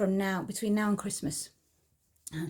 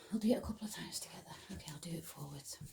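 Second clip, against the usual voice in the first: whispered aside; 3 semitones lower, 9 dB quieter, much faster than usual. Real, not acted. Aside while demonstrating routine.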